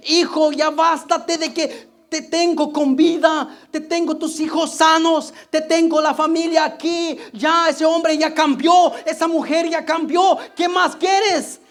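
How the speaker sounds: loud, high rebuke building to a shout; God scolding. Acted. God shouting at a never-satisfied child.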